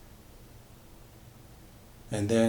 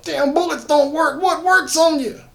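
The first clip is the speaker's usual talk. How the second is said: much higher, frantic panic; panicked; 6 dB louder than usual. Acted. Drummond shooting the monster, higher panicked yelling. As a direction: squeal it as the gun fails.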